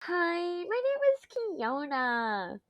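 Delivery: high, slow cutesy intro voice; self-imitation test. Shown as a demonstration. Streamer testing whether she really sounds like the mock, high and slow. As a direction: try out the voice to check it. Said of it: "do I actually sound like that?".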